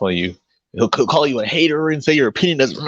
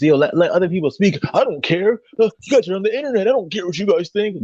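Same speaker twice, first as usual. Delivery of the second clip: high whiny mock of him, faster mid-way; mocking imitation; 7 semitones higher than usual. Mocking the rival debater on stream, high whiny parody. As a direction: parody his dismissive brush-off in a raised voice. Mocking S0's dismissive words.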